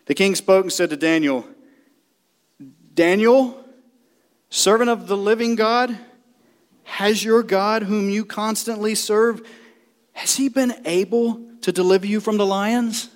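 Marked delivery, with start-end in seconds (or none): 2.9-13.1 troubled cry. Acted. King calling down to Daniel in the den, troubled and raised. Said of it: "he cried out with a troubled voice".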